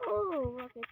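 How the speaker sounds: normal talking voice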